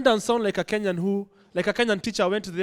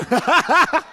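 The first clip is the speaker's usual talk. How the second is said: a sudden high laugh, louder; high burst of laughter; 7 semitones higher, 6 dB louder than usual. Real, not acted. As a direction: crack up at your own joke. Laughs at own joke.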